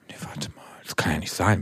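hushed, unvoiced musing while searching for a word; whispering to herself. Real, not acted. Searching her memory for a favourite insult, whispered aside. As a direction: whisper it under your breath as you think.

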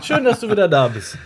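laughing with relief, voice lifted. Real, not acted. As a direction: say it through a real laugh. Partner back after connection drop.

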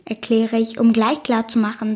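ordinary delivery, nothing marked

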